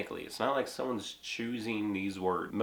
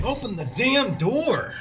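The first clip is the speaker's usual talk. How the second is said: slow, high, loud shouted order; emphatic demand; 11 semitones higher, 7 dB louder than usual. Shown as a demonstration. Someone locked out, yelling a slow angry demand. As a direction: bang on the door and yell it. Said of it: "empathetic swearing".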